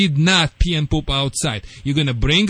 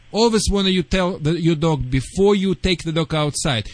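usual speaking voice

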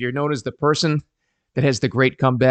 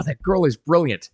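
ordinary talk, nothing marked

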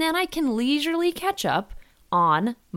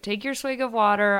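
normal talking voice

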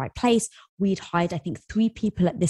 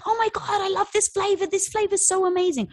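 much higher, mock-gushing fan voice; 12 semitones higher than usual. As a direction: imitate an over-the-top excited customer. Imitating gushing Facebook fans.

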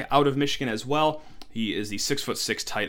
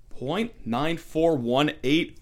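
slow emphatic repeat; slower than usual. Real, not acted. Repeating the rating for effect.

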